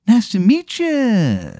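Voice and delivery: high, slow, drawn-out stranger voice; odd stranger's greeting. Acted. Stranger in shades greets player, high and slow. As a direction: greet them in a high put-on voice.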